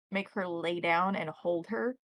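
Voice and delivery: ordinary delivery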